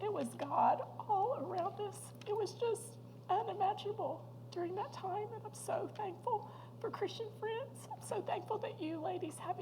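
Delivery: fighting back tears while giving thanks; real tearful catch in the throat; choked up. Real, not acted. Tearful testimony about grief.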